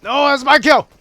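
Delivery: very loud, high-pitched protest; outraged yell. Real, not acted. Gamer protests that the kill is his, outraged yell. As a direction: scream it at a kill thief.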